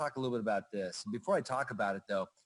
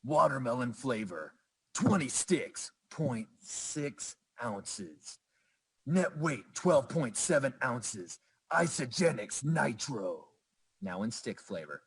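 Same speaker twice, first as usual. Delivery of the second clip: movie trailer voice; slower than usual. Shown as a demonstration. Presenter reading a product label for fun, gravelly movie trailer voice. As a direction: growl it like a movie trailer announcer. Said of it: "read it with that gravelly voice".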